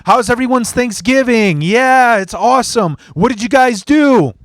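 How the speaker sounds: big high-pitched stage enthusiasm; hyped-up host. Retold or imitated. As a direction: work the crowd like an emcee. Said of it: "to make the crowd jacked".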